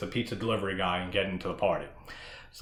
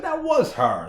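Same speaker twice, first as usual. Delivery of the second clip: surprised realization; 4 dB louder than usual. Retold or imitated. Realizing it's the same actress.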